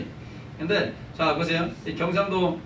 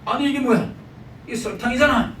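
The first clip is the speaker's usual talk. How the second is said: 투덜대는 남편; 8 semitones higher than usual. Acted. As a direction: act out a husband griping in raised pitch. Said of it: "남편은 눈살을 찌푸리면서 투덜거렸다고 합니다". (투덜거리며 높고 크게 따지듯)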